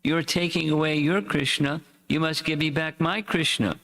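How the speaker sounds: high, tearful pleading cry; high anguished cry, voice pushed up; mother crying out. A narrator's reading. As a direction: wail it out like a mother who lost her son. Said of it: "She started to cry".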